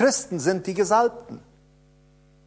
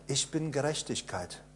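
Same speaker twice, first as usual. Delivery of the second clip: softly, much quieter, low; quiet confession; 5 semitones lower, 13 dB quieter than usual. Shown as a demonstration. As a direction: say it to yourself, almost under your breath. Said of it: "Sag's doch mal".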